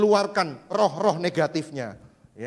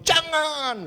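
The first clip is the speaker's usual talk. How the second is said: high, sharp cry of no; high pretend shout; mock scream; 10 semitones higher, 4 dB louder than usual. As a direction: yelp it out in alarm. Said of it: "langsung teriak kamu".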